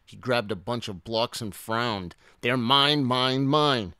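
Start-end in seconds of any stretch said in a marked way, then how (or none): 2.4-3.9 loud, higher greedy outburst; snatch them with your voice; insistent dinosaur shout; possessive shout. A narrator's reading. Hoarder grabs the blocks.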